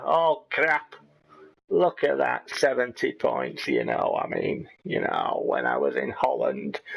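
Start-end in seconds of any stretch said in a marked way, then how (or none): 3.7-6.8 English in a put-on Dutch accent, much lower; imitating a Dutch-accented manager; low, mocking Dutch-accented English. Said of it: "I talked like this in Holland".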